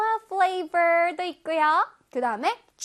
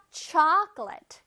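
chocolate as CHAHK-lit, punched on CHAHK; stress on first syllable; much slower than usual. Shown as a demonstration. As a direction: hit the first beat of chocolate, quick short ending. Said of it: "C H O C의 강세가 들어가서".

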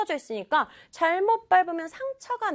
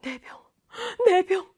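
put-on gasping, stunned child; small shocked voice, breath caught; acted shock; 6 semitones higher than usual. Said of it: "이런 느낌이에요".

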